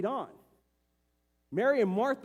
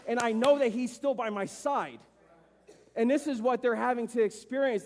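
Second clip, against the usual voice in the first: rising, louder preaching build; preaching at full force; 3 semitones higher, 3 dB louder than usual. Acted. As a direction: lift the congregation higher and higher.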